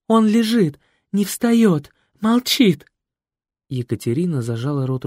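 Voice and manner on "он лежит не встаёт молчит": high, louder panicked plea; distraught. Acted. Лена pleads distraught over her dead uncle. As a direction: cry out in despair.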